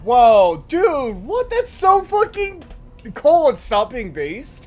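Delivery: loud, high hype reaction; excited shouting. Real, not acted. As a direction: shout it in disbelief.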